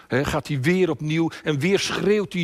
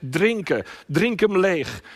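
loud, raised preaching voice; forceful command; 5 semitones higher, 4 dB louder, slower than usual. Retold or imitated. Father with the cup ordering his son, forceful command. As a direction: press the order home, high and forceful.

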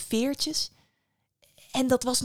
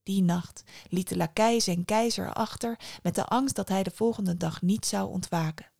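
plain reading voice

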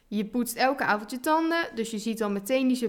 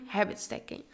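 whispered; 2 semitones lower, 7 dB quieter than usual. Acted. Whispered answer to own question.

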